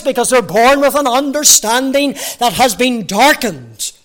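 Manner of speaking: loud, high, impassioned preaching; fervent preaching. Acted. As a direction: preach it out with full force. Preaching at full force.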